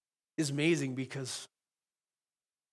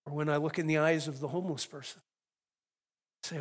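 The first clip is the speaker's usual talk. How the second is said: normal talking voice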